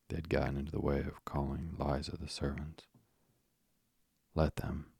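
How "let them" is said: ordinary delivery, nothing marked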